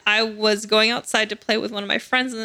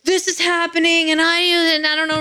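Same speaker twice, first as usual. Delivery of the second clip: alarmed neighbor mom; 6 semitones higher than usual. Retold or imitated.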